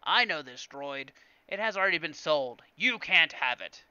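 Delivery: put-on merchant voice, firmly turning down a customer; Watto. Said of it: "I can't do Watto's voice".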